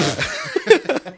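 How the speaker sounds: very high embarrassed giggle; high laugh. Real, not acted.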